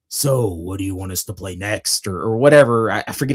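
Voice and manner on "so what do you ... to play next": lightly voiced low singer addressing the crowd; frontman asking crowd. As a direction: imitate a frontman's low, offhand question to fans. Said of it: "[name] starts asking the crowd".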